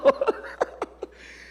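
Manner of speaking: airy burst of laughter; breathy laugh. Real, not acted. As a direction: pastor cracking up at his son's slipper game. Laughing at son's joke.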